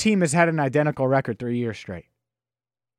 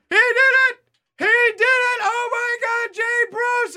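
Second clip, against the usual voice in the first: high, shrieking disbelief; ecstatic screaming. Retold or imitated. Roommates screaming as Jay Bruce clinches the division, ecstatic. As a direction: scream it like the pennant just got clinched. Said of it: "an accurate assessment of how it sounded".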